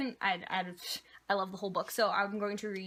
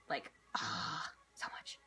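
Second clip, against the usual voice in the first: breathy whisper of delight; soft whispered swoon over cupcakes; whispered gush; 10 semitones lower, 7 dB quieter, slower than usual. Real, not acted. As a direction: whisper it like a guilty pleasure. Gushing aside about loving cupcakes.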